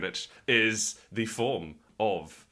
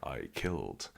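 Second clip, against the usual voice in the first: low, quiet and solemn; quiet Morpheus voice; 6 semitones lower, 5 dB quieter than usual. Acted. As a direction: play it grave and hushed.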